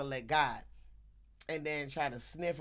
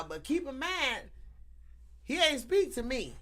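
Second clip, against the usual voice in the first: normal talking voice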